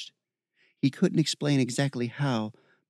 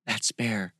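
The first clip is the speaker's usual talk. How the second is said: ordinary delivery, nothing marked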